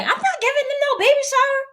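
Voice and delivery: fast, very high outraged protest; indignant refusal. Retold or imitated. Woman answering God about baby shower, indignant refusal. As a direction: balk at it in a high rush.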